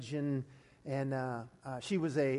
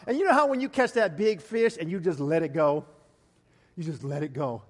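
usual speaking voice

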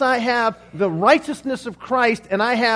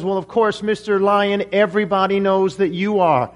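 ordinary talk, nothing marked

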